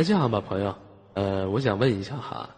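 ordinary delivery

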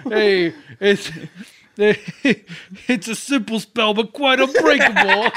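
laughing, loud, high mock Brooklyn; Brooklyn accent. Friend quoting a spell line, laughing Brooklyn accent. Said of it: "sounds like that in Brooklyn".

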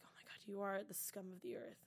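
hushed whisper of disgust; mock whispered outrage; whispered insult. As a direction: whisper it under your breath in disgust. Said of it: "And I was just like".

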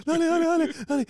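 high, quick urging chant. Retold or imitated. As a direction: egg the screen on, impatient. Urging the page to load.

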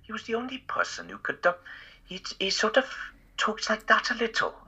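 high-pitched put-on Welsh accent; Rob Brydon. Shown as a demonstration. Impressionist showing a friend his rusty Welsh, mock Welsh accent. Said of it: "do an impression of Rob Brydon".

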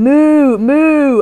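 loud, high mock cow moo. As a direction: moo at the shopkeeper to ask for milk. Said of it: "So I started going".

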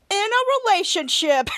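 high, drawn-out happy declaration; gleeful announcement. Retold or imitated. New couple finishing their status, gleeful announcement. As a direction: stretch it out with delight.

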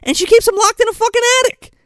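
loud, very high-pitched disbelief; exasperated outburst. Real, not acted. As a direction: fire it out high and incredulous. Outraged reaction to grandma's behaviour.

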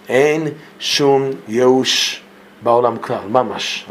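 Ein shum yeush drawn out very slowly, word by word; emphasized slowly. Shown as a demonstration. Teacher stressing no despair to his class, very slow. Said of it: "we're gonna emphasize this point".

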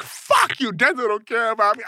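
high-pitched mock drunken grievance; imitating angry drunk. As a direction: play the aggrieved drunk friend, starting high. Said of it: "Ricky's an angry drunk".

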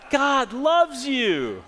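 high, loud and slow with feeling; mean every word of it; said like you mean it. Shown as a demonstration. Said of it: "say it like you mean it this time".

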